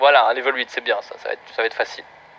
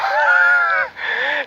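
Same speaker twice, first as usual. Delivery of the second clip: shrill burst of laughter; squealing laugh; high shrieking laugh. Real, not acted. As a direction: cackle high at his own disaster. Laughs at his own bad deck.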